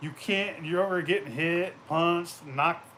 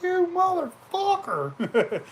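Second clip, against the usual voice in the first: slow, high, drawn-out yell; outraged yell; 14 semitones higher, much slower than usual. Retold or imitated. Shot in the leg by his buddy, a slow high outraged yell. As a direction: drag it out in outrage at the one who shot you. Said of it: "I was like".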